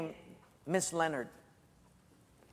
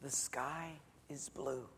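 whisper; 3 semitones lower, 13 dB quieter, slower than usual. Retold or imitated. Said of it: "She'd whisper something like this".

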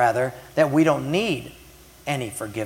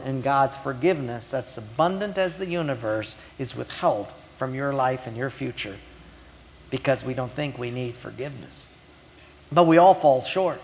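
ordinary delivery

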